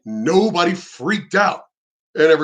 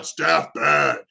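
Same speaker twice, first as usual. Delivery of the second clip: lightly put-on dumb monster voice; two blunt, separate monster words, a bit low; a hint of lumbering brute; Hulk or Frankenstein; 3 semitones lower than usual. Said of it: "it's like the Hulk or Frankenstein".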